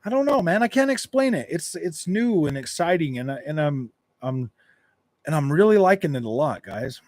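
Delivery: ordinary talk, nothing marked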